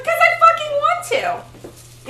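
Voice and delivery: high, drawn-out mock-defiant comeback; exaggerated defiant wife squeal; defiant retort. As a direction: push it up high and loud, stretching the words. Acted retort to her husband.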